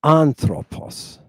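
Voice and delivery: "Anthropos" drawn out slowly with a falling end; slow teacher's model of "Anthropos"; slow model word. Shown as a demonstration. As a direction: say "Anthropos" slowly so they can copy. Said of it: "I'm gonna read a few of these words".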